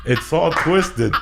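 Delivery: ordinary delivery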